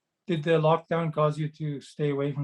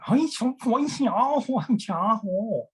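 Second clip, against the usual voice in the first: much higher, put-on cartoon monkey voice; Chinese monkey voice; 8 semitones higher, much slower than usual. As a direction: squeak it out like a playful cartoon monkey. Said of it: "we did Chinese monkey".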